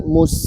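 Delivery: "mus" closed on a long s, no vowel; sukun on s. Shown as a demonstration. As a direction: sustain the final s of "mus". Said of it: "S-nya sukun".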